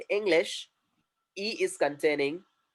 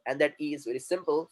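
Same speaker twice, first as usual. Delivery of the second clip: ordinary talk, nothing marked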